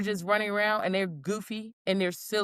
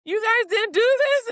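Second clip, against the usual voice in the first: much higher, louder, incredulous; surprised childlike question; 13 semitones higher, 6 dB louder than usual. Retold or imitated. Telling coworkers about the bouncy mats, surprised squeal. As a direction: squeal it like a delighted kid. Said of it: "I'm like".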